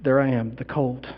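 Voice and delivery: barely voiced wonder in the dark; hushed, from the grave. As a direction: leave long silences between faint words. Acting out lying dead in darkness.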